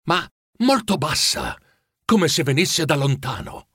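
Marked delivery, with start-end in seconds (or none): none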